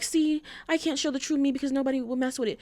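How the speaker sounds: high, quick put-on complaint; mock self-pity. Mimicking someone who blames others for rejecting them, mock self-pity. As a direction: imitate someone making a self-pitying excuse.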